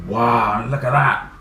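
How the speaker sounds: ordinary delivery, nothing marked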